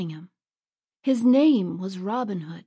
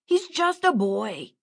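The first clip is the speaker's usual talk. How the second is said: pleading bandit; 2 semitones higher, 4 dB louder than usual. Acted. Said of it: "argued a fourth man".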